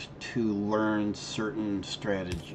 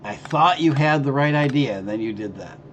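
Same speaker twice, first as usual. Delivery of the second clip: exasperated; 5 semitones higher, 9 dB louder than usual. Real, not acted. Mock disappointment at teammate.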